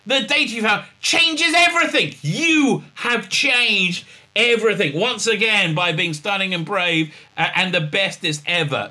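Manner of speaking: sappy parody praise; mock gushing. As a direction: overdo the admiration. Parody of Stamets praising Burnham.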